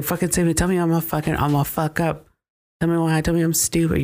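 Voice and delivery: high-pitched mock rant; retold outburst. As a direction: act out someone losing it, pitched well up. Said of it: "Luke just fucking goes off".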